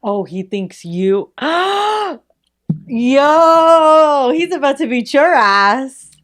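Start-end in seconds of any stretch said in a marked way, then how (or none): 1.4-2.2 high, drawn-out gasp; shocked gasp. Real, not acted. Listener hearing the knife story, shocked gasp. 2.9-6.1 high, loud, drawn-out "yo" easing slightly; shocked excitement. Real, not acted. Listener warning the storyteller of a beating, shocked excitement.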